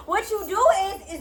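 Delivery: ordinary talk, nothing marked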